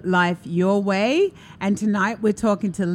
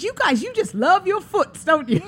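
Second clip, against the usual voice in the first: put-on much higher, laughing little girl; high kid voice; 11 semitones higher than usual. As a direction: tease them like a giggling child. Child teasing about foots.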